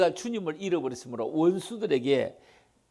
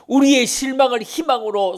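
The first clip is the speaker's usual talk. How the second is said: loud, higher proclaiming; preaching at full force; 9 semitones higher, 10 dB louder than usual. Real, not acted. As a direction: thunder it out from the pulpit. Preaching climax. (설교하듯 힘차게 외치며)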